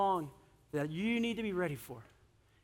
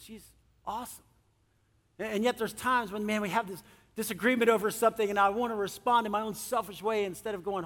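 normal talking voice

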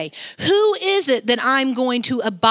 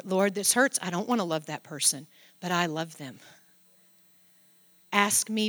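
softer and lower, tender prayer; 7 semitones lower, 7 dB quieter than usual. Pretend. Believer prays honestly to the Lord, soft and tender. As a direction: pray it gently as if hurting. Voicing a prayer and God's answer.

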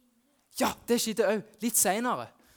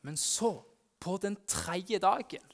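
ordinary talk, nothing marked

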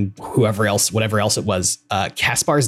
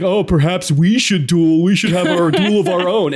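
dumb mocking voice; 6 semitones higher, 4 dB louder than usual. Edelgard mimics Ferdinand's duel suggestion in a dumb voice. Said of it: "puts on, like, a dumb voice".

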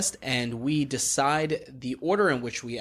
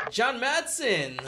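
excited call-out; 9 semitones higher, much slower than usual. Real, not acted. Host calls out guest's name.

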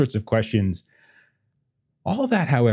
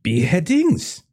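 intrigued French mother; 6 semitones higher, 5 dB louder than usual. Acted. French mother learning of beheadings here, fascinated. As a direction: marvel at grisly news with a French accent.

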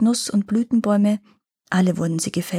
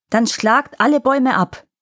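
commanding king; 4 semitones higher, 4 dB louder than usual. Acted. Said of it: "befahl der König".

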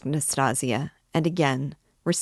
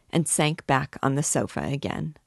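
ordinary delivery, nothing marked

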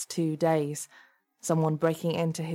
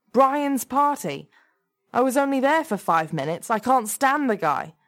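high, loud, incredulous protest; exasperated, pitched-up denial; indignant; 7 semitones higher, 5 dB louder than usual. A narrator's reading. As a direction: deny it with raised voice. Man indignantly denies involvement.

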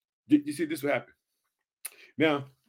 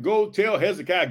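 usual speaking voice

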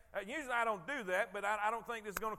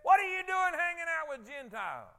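higher, louder scolding question; accusing rebuke; 6 semitones higher, 6 dB louder than usual. Retold or imitated. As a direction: call them out for breaking tradition. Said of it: "You know what they said".

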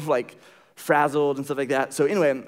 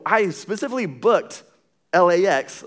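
higher, louder retold protest; indignant; 4 semitones higher, 3 dB louder than usual. Customer protesting to the rental agent, indignant. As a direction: push the words out in indignant complaint.

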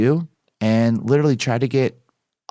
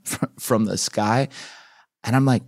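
usual speaking voice

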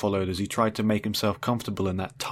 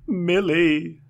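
mewling; 8 semitones higher, 4 dB louder than usual. Acted. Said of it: "he mewled".

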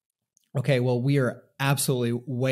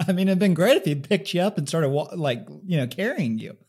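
laughing while joking; 6 semitones higher, 5 dB louder than usual. Real, not acted. Joking about being carried.

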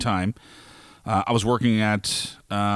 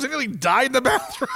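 high, strained outcry half in laughter; mock shout; 11 semitones higher than usual. Choking guy telling the bus, high strained outcry. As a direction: yelp it up high as if still shaken. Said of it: "And he goes".

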